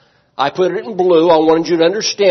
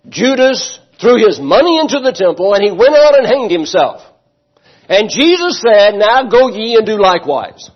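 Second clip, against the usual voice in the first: loud, raised quoting; mock scripture quote; 5 semitones higher, 5 dB louder than usual. Shown as a demonstration. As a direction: proclaim the twisted verses as if holy. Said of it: "I quote".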